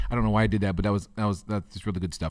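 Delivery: normal talking voice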